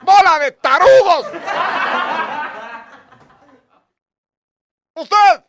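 loud insulting yell; angry shout. Retold or imitated. Teacher yelling insults at his students, angry shout. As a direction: berate the whole class.

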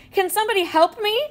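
high, loud call for help. Acted. Stuck boy calls classmates for help, loud and high. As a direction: call across the room for help. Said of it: "I call".

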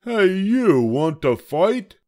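high, loud, grumpy little bug; a tiny bug spoiling for a fight, high and loud; grouchy ladybug. A narrator's reading. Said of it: "the grouchy ladybug said".

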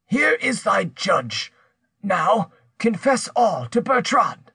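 slow, high-pitched, commanding exclamation; vehement. A narrator's reading. Pierre drags Rose before her judge, vehement. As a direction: order her to confess, slowly and forcefully. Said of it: "He exclaimed vehemently".

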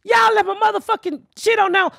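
furious call to the bus company about abandoned bags; retold phone outburst. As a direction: let him have it. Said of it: "So I called him and I said".

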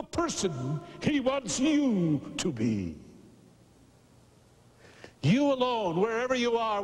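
impassioned preaching. Acted. Preacher invites congregation to become Christians, impassioned preaching. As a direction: make a soaring pulpit appeal to each listener.

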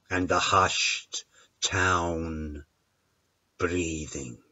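soft, slow and hushed. A narrator's reading. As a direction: let it fade to a breath. Hushed town breathing.

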